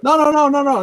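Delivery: high rapid string of no's; retold dealer refusing the stranger; mock flat refusal. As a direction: shut him down, hammed-up. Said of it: "He's like".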